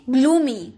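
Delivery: ग्लूमी said clearly as glumi, long oo; pronunciation demo. Shown as a demonstration. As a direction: model ग्लूमी cleanly for students to copy. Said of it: "यहाँ उच्चारण आप देखिए".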